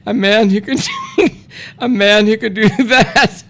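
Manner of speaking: words breaking up with giggles; fighting back laughter while reading; laughing through it. Real, not acted. Reading the ad aloud, cracking up as he reads. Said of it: "God, I'm gonna laugh".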